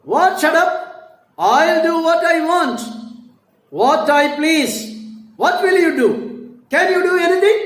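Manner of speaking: high, loud defiant yell; defiant shouting. Acted. Wife defying her husband at full voice. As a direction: throw it back at him, daring.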